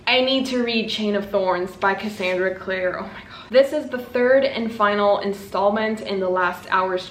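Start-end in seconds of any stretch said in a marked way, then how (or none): 3.0-3.5 breathless whisper; hushed, awed whisper; whispered awe. Real, not acted. Gushing over the book.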